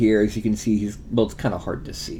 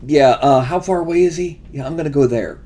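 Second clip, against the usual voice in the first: player voice; 5 semitones higher, 6 dB louder than usual. Shown as a demonstration. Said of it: "Do you prefer just sitting around".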